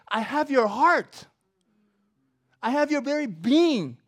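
loud, high, fervent preaching; full-force preaching. Acted. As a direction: drive Paul's words home to the congregation. Preaching about following Christ.

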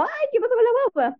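high, rapid mock-alarmed voice; alarmed daycare worker. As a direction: imitate a daycare worker fussing over a toddler. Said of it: "llega como una de las gallas del jardín".

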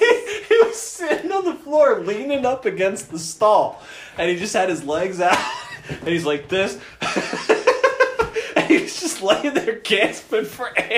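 high-pitched giggling while telling it; laughing. Real, not acted. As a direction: talk through uncontrollable laughter. Laughing through the story.

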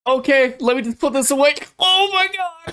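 very high, hammed-up child voice; mock excited kid. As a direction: squeal it like a kid greeting an uncle. Acting out kid hugging uncle.

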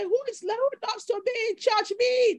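playful imitation of a cheering congregation; mock call and response.

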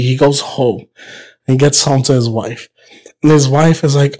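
ordinary delivery

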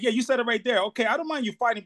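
ordinary talk

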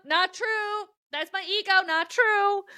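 high, shouted "Not true"; said out loud. Shown as a demonstration. Coach demoing how she rebuts her ego, high and shouted. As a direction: shout down the inner critic. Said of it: "I have to say it out loud".

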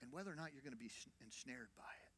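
breathy whispered emphasis. Acted. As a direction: whisper it like a warning. Pastor drops to whisper for emphasis.

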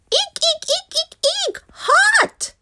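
very high cartoon squeals; squealing in fright. A narrator's reading. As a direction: squeak like a scalded mole. Lava pours into tunnel.

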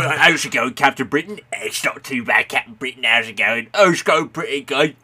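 hammed-up Cockney chat between Captain Britains; lightly put-on Cockney, cheery; mock Cockney accent. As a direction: lads meeting down the pub, mock Cockney banter. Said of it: "Cockney British accents".

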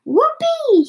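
high, loud storybook whoop; gleeful shout. A narrator's reading. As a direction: whoop it out at the top of your range. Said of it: "he shouted".

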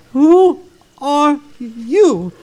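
slow, firm, spaced-out words; insistent demand. Acted. Ichabod unanswered by the specter, slow insistent demand. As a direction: demand it deliberately, leaving each word to ring. Repeats demand after no answer.

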